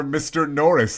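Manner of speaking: usual speaking voice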